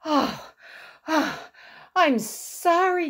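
acted out of breath, pitched high; gasping. A narrator's reading. Frog back from chasing the list, gasping. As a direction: pant between words after a long run. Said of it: "gasped Frog".

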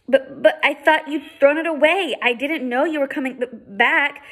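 high, loud stuttering protest; girl stutters back at the stranger, high and loud; stammering frightened girl. Acted. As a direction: blurt it out in a panic.

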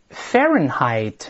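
"Fahrenheit" slowly, FAIR-en-hite, middle H silent; slow model reading. Shown as a demonstration. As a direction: read "Fahrenheit" slowly, no H sound. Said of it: "把这华氏先大声读一遍". (慢读"Fahrenheit"，中间H不发音)

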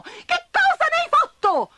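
high, loud alarmed ant voices; ants crying out. A narrator's reading. Ants seeing their anthill gone, high loud alarm. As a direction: cry out like ants who lost home.

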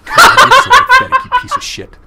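hammed-up rage, laughing through it; mock furious shout. Cop screams insults at the suspect, in hammed-up laughing fury.

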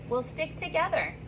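ordinary delivery

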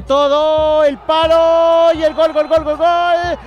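long high goal scream; goal commentary shout. Real, not acted. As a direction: belt out the goal call.

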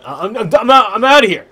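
high, loud stammering yell; mock panicked exit. Streamer declaring he is leaving, mock panicked yell. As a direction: yell it as if bailing out. Mock quitting after barrier news.

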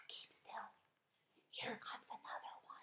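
very soft, barely audible whisper. A narrator's reading. James warning the earthworm of another seagull, whispering. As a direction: whisper a warning, keeping them motionless. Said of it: "whispered James".

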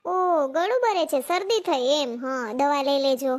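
slow, drawn out, under the weather; slowly, like nursing a cold; slow sick-voice talk. Acted. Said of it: "કેમ અવાજ ધીમો છે તમારો?".

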